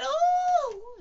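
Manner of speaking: long, very high loud "ohhh"; jolted "oh". Acted. Piglet flung up in the air, high drawn-out cry. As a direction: squeal it as if tossed upward. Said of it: "as he went up in the air".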